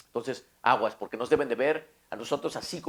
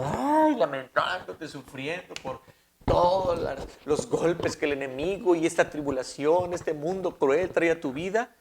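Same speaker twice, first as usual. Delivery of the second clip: mock lament; 5 semitones higher, 7 dB louder than usual. Preacher mimics a lamenting believer.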